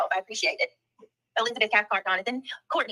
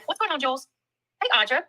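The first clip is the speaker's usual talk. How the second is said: usual speaking voice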